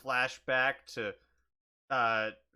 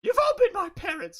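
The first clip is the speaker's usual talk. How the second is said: put-on high little kid voice; small child voice; 7 dB louder than usual. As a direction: whine like a fed-up child. Pablo voice.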